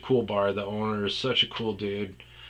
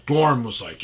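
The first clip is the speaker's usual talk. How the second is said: ordinary delivery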